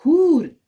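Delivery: हूर called out loud and high with a full oo; teacher stressing the open oo in हूर; open waw demo. Shown as a demonstration. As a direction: belt हूर with a long open oo. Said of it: "गो इसको हम वाव मारूफ कहते हैं".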